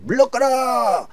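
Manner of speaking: high, slow drawn-out proclamation shout; herald's cry. Acted. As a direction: cry it out to clear the street. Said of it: "이런 거예요".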